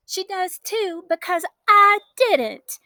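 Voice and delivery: high, slow snippy retort. A narrator's reading. Sister snipping back at the girl. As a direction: snip back at her. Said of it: "Kelsey Ann snipped".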